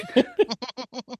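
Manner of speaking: soft high giggle. Real, not acted. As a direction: let out a small high giggle. Laughing at the jank.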